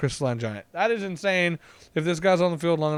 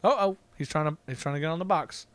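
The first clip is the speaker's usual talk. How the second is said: normal talking voice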